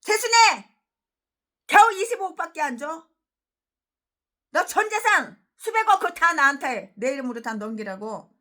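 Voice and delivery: a high, loud, put-on voice of a greedy pastor; greedy demand. A greedy pastor threatening the preacher, in a loud put-on voice. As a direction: yell the demand for all her money. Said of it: "얼마나 협박을 하는지".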